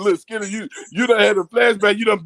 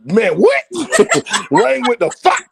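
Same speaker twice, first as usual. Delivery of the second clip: normal talking voice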